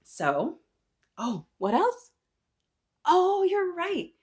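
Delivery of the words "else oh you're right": high, slow and drawn out; delighted praise. Real, not acted. Teacher answering a child who guessed Family Day, high delighted praise.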